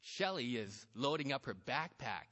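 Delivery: ordinary delivery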